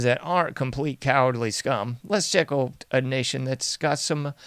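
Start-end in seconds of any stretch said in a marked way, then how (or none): none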